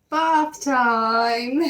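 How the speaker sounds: high, loud teasing announcement; spring the surprise on the kids; gleeful bath-time reveal; playful reveal. Pretend.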